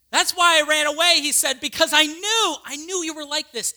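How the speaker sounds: a prophet blurting out his grudge; Jonah's outburst. Acted. Preacher voicing Jonah, an angry loud outburst at God.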